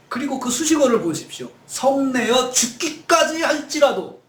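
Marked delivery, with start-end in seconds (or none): 1.7-4.2 high, slow, acted angry emphasis; angry outburst. Jonah blurting at God in anger, indignant outburst. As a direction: drag out each word in mock indignation. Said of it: "화가 나니까".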